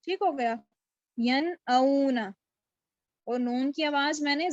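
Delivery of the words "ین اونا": slow ین اونا, stretching the glide in اونا; madd leen. Shown as a demonstration. Teacher demonstrates madd leen to students, slow stretched glide in ین اونا. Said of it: "تو یہاں پر مد لین بھی ہوگی".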